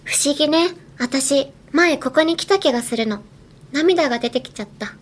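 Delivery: gentle scene read of the phrase; read as the scene line. Shown as a demonstration. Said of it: "まず、".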